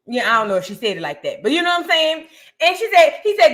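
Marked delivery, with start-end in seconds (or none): none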